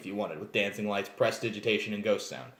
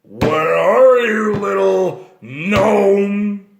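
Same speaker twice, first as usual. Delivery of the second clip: slow, loud, looming giant voice, drawn out; menacing half-giant; 4 semitones higher, 8 dB louder, much slower than usual. Acted. Half-giant hunting the gnome thief, slow and menacing. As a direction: stalk your prey in the dark. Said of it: "The big half-giant wielding a huge glass greatsword".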